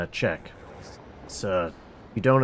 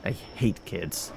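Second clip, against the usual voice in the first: grumble; 6 semitones lower, 8 dB quieter, slower than usual. Acted. Grumbling after robot cries.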